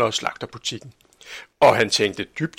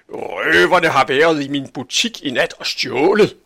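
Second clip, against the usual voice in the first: higher, louder outraged report; indignant complaint; 4 semitones higher, 6 dB louder than usual. A narrator's reading. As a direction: complain loudly about the robbers. Angry butcher reports the robbery. (højlydt forarget)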